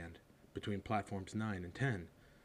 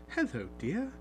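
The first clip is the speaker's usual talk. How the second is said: higher, kindly mum; warm motherly greeting; 11 semitones higher than usual. Acted. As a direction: greet the boy warmly.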